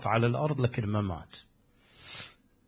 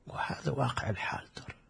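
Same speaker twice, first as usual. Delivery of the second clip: همسة خافتة للجمهور; همس؛ يهمس بها للمستمعين همسا؛ whispered aside; 10 dB quieter than usual. Real, not acted.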